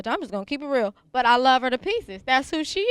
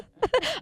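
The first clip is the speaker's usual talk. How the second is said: giggling laugh pitched up; high burst of laughter; 11 semitones higher than usual. Real, not acted. Host laughing after teasing the sheriff, high laugh. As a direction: laugh at your own boldness.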